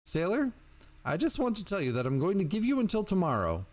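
high-pitched threatening caller; dangerously high voice. A narrator's reading. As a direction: deliver an ultimatum in a high, agitated voice. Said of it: "growing dangerously high".

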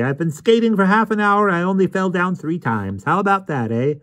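high-pitched, cheerful bragging; happy. A narrator's reading. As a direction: proudly tell a friend, happily and high. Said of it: "called Miss Eels as she waved happily".

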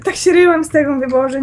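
ordinary talk

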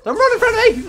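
furious shout at a teammate in the way; angry yell. Real, not acted. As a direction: yell it at the teammate blocking you. Teammate runs into his shot.

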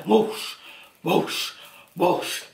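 short whooshes spaced apart; whooshing sound effect, lightly voiced; imitated swimming whoosh. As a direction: mimic strokes through the water.

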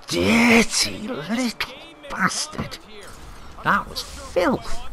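high, slow indignant yell; outraged shout. Real, not acted. Gamer railing at the dirty opponent, high outraged shout. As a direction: drag out the insult in outrage.

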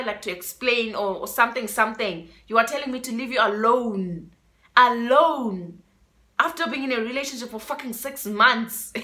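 ordinary talk

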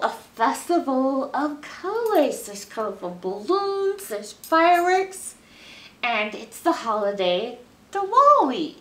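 ordinary delivery

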